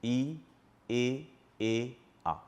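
ই, এ, এ, আ drawn out one by one, lips flat and the mouth opening wider each time; vowel demo. Shown as a demonstration. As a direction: sound out ই, এ, এ, আ from closed to open mouth. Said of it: "আপনার ঠোঁট দুটি ছিল চ্যাপ্টা".

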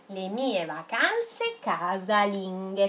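drawn out, word by word; slow dictation. Shown as a demonstration. Teacher dictating to children writing along, slowly. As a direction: read it slowly so the pupils keep up.

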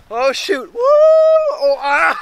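loud startled shrieks on the swing; screaming while falling. Real, not acted. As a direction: yelp as the swing drops. Friends tipping over on giant swing.

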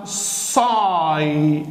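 "صاي" stretched slowly, saaay; long "eye" vowel. Shown as a demonstration. Teacher shows Y pronounced as eye here, "صاي" slowly drawn out. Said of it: "هنا هتتنطق اي".

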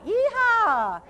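high, loud cowboy whoop; yeehaw cheer. Acted. Playful cheer mid spelling.

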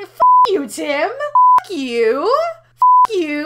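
screaming curses at full volume, high and loud; furious shout. Acted. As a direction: yell it at the traitor with everything you've got. Betrayed by the alien Tim.

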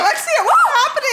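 alarmed laughing shout. Real, not acted. Mom alarmed by the screen.

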